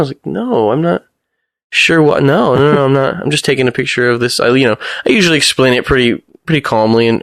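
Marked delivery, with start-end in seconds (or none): 0.2-4.4 lightly raised, flustered denial; retold own reply. Photographer replays his flustered denial to the resident, a bit higher.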